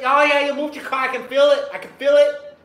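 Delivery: loud, high mock woman in bed; moaning woman. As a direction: ham it up high and loud, like she feels it. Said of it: "She's like".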